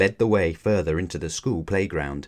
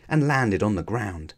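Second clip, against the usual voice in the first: ordinary delivery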